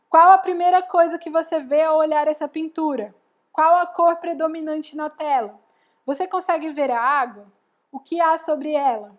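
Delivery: put-on picture-book questions read aloud to a child. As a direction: read each question out to the child, inviting an answer.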